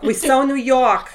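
put-on loud, playful New York accent; jokey New York voice with a laugh. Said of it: "do a New York accent".

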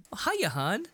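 high sugary neighbor greeting; chirpy nosy neighbor voice; nosy small town gossip. Retold or imitated. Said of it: "she clicks over there and just like".

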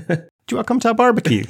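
high, fast, hammed-up villain invite; hammed-up creepy host voice; mock pig man. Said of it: "you would think the pig men would be like".

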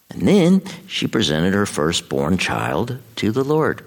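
usual speaking voice

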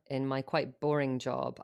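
ordinary talk, nothing marked

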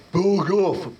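bellowing troll. Acted. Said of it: "bellowed the ... giant troll".

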